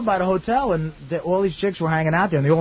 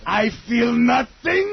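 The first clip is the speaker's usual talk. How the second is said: mock German deadpan; 6 semitones higher, much slower than usual. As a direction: drag it out like a cold German.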